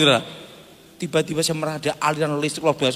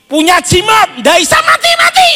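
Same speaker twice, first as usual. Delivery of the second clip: higher, louder cry on "nggak bisa mati-mati"; preaching shout; 9 dB louder than usual. Real, not acted. Preacher shouts the punchline to the congregation, loud. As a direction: belt it out to the congregation.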